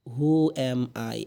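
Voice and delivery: slow, drawn-out Who am I; slow repeat. Shown as a demonstration. Teacher repeats the question slowly for students. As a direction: say it slowly again for practice. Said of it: "এরপরে দিয়ে আছে".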